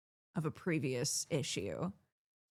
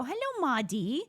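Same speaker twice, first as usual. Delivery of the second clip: sing-song greeting; 8 semitones higher than usual. Retold or imitated. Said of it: "I go".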